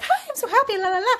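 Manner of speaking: high, loud, giddy sing-song imitation; bubbly. Retelling how a bubbly client greeted her, mock bubbly. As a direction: play up a gushingly happy woman. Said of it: "she's just so bubbly".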